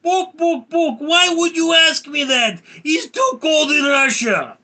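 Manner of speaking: put-on loud, high squawking chicken with a Russian accent. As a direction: cluck and shriek like an indignant Russian chicken. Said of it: "we asked chicken about Russia".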